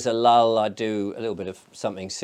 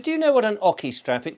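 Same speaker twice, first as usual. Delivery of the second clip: higher Australian accent, amused imitation; Barbara's Australian accent; 10 semitones higher, 3 dB louder than usual. Barbara O'Neill asking about an ocky strap, Australian accent. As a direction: ask it in a lilting Australian voice. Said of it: "'Cause she's Australian".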